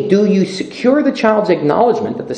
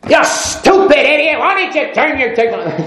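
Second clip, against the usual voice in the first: hammed-up driver's outburst; mock road rage; 10 semitones higher, 4 dB louder than usual. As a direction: bark it like a furious dad behind the wheel. Dad yelling at driver in car.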